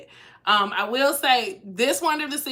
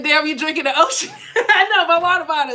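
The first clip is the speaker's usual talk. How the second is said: higher, louder, laughing through it; 6 semitones higher, 7 dB louder than usual. Real, not acted. Joking with viewers about her huge water bottle, laughing. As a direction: talk through a real laugh.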